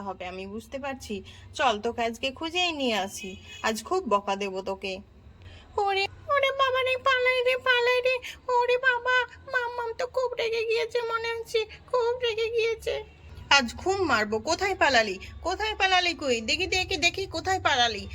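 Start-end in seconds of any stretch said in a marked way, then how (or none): 5.8-12.9 put-on a little girl running from a beating; mock terrified child fleeing; panicked child.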